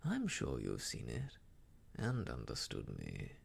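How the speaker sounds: very low, insinuating suitor's voice. A narrator's reading. Mister Elton sure Emma understood him, insinuating. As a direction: drop deep and imply a shared secret. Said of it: "in an accent meaning to be insinuating".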